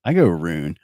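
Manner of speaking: ordinary delivery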